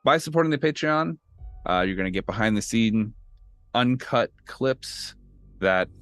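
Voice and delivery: upbeat podcast sponsor read. Acted. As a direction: sell the Patreon like an announcer.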